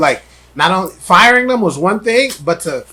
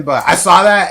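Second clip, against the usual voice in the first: ordinary delivery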